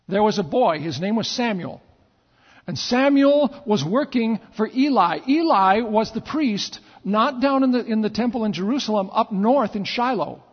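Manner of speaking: ordinary talk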